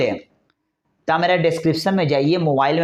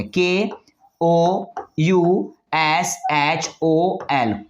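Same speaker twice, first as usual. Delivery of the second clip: letters read slowly and separately; slow spelling; much slower than usual. Shown as a demonstration. Teacher showing an alternative spelling, slowly. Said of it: "नहीं तो ऐसे लिखेंगे".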